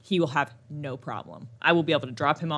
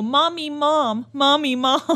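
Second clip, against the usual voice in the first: put-on higher, slow toddler calls; imitating toddler; 7 semitones higher, slower than usual. Mom imitating her son mixing mommy and mom, higher. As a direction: whine it like a little kid. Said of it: "he'll go".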